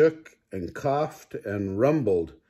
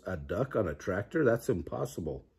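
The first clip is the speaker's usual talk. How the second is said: softer, quicker disbelief; quiet disbelief; 3 semitones lower, 7 dB quieter, faster than usual. A narrator's reading. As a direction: mutter it to yourself. Grandma's disbelieving thought.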